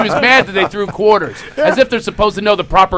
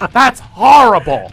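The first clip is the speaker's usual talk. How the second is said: slow, drawn-out and louder in shock; mock indignant; 3 semitones higher, 5 dB louder, slower than usual. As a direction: play up the mother's outrage, slowly. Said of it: "she's all indignant and all upset".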